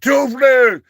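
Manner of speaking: mock shout. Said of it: "he said".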